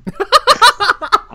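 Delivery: high, loud cackling laugh; loud burst of laughter. Real, not acted. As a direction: burst out laughing at your own misery. Laughs at dying inside line.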